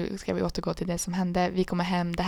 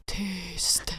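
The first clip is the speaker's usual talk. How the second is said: unvoiced whispered hush; whispered shut up to a friend; 7 dB quieter than usual. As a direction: hiss the word softly. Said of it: "Och du bara".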